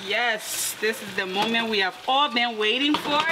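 ordinary talk, nothing marked

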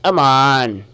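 normal talking voice

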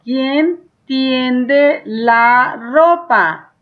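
¿quién tiende la ropa? stretched out word by word, very slow; teacher dictating the question; very slow dictation. Shown as a demonstration. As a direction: draw out every word for the child to copy. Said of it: "Y ahora".

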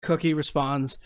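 usual speaking voice

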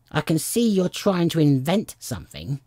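high-pitched accusing bird; distrustful bird's retort; suspicious pigeon. A narrator's reading. Pigeon accuses Alice of inventing.

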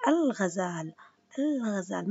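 الغزال with the l of ال fully sounded; teacher modelling الغزال with a clear l; clear moon lam. Shown as a demonstration. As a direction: say al-ghazal, l audible before غ. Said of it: "اسمعوني كيف حكيت".

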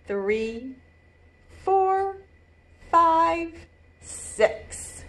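slow, spaced count and storybook read; deliberate picture-book rhythm; reading to children. A narrator's reading. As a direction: set each word apart for little listeners.

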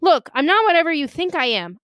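high, pressured pleading; strained protest. A narrator's reading. Boy with knife on his windpipe, straining to protest. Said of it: "I croaked".

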